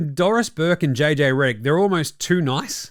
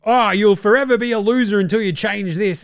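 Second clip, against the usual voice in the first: mock scolding pundit; 6 semitones higher, 4 dB louder than usual. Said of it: "To be like".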